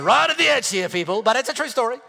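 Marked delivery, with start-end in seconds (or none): 0.0-0.9 much higher and louder on the repeat; emphatic repeat. Real, not acted. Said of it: "I'm right on the edge here".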